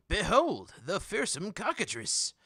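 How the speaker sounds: loud, high barker's announcement; grand showman reveal. Acted. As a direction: unveil it like a carnival barker. Tore its sheet off with a flourish.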